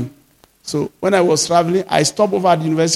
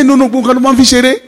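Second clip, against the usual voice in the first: excited retold pastor; 6 semitones higher, faster than usual. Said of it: "He say".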